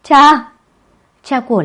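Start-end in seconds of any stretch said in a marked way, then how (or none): 0.0-0.5 high loud call; crying out. A narrator's reading. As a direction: shout for your father. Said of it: "La Y kêu to".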